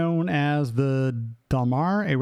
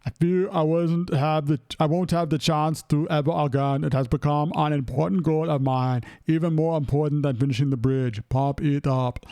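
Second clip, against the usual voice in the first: put-on heavy accent, deliberate delivery; hammed-up celebrity imitation; Arnold. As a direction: read it as an amateur action-star impression. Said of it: "Read it like Arnold".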